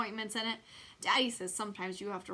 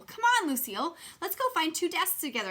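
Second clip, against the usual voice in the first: eager; 7 semitones higher, 4 dB louder than usual. A narrator's reading. Junie B eagerly pulling her friend.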